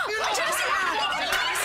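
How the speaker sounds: gasp, then high urgent shout; startled, shout them into running; panicked shout. Acted. Pursuers appear again, panicked.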